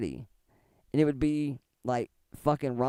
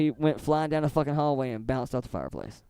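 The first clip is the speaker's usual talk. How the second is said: ordinary talk, nothing marked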